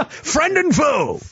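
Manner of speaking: high, slow, laughing through the words. Real, not acted. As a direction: giggle the phrase out slowly. Laughing at own camera joke.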